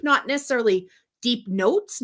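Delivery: ordinary talk, nothing marked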